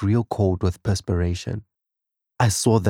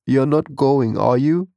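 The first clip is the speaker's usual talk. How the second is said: anxious plea; 6 semitones higher, 4 dB louder than usual. A narrator's reading. Husband dreading his wife's death.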